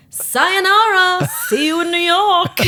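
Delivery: high, slow, loud sing-song farewell; drugged child goodbye. Retold or imitated. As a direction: wave it off dreamily from the gurney. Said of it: "I was going".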